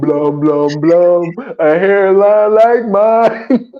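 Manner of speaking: drawn out, slow mock threat; slow mock bravado with nonsense beats; slow movie quote. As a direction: stretch it out like the movie line. Quoting movie line, stretched.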